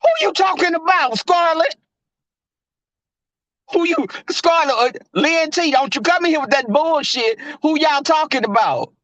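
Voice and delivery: high-pitched, indignant challenge; confrontational call-out. Real, not acted. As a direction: confront them, pitch pushed well up. Challenging viewers in the chat.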